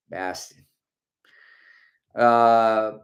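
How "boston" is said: softly put-on Boston accent. Host recalls hearing locals in Boston, Boston accent. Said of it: "tried to do a Boston accent".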